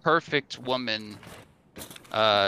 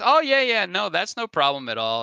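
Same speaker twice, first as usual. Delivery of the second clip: high, louder eager reply; friendly Jarvis; 7 semitones higher, 5 dB louder than usual. Acted. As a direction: chirp it out, happy to share. Jarvis voiced in joke.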